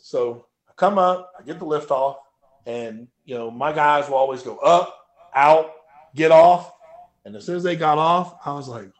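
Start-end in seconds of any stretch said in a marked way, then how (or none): 4.6-6.7 louder, punchy lifter's commands; called-out commands. Retold or imitated. Crew calling the lift-off to the lifter, called out loud. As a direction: call out each command to the lifter.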